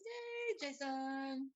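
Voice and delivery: high, bright cheer of welcome; excited cheer. Real, not acted. Host cheering Jason's arrival, excited high cheer. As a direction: cheer him onto the stage.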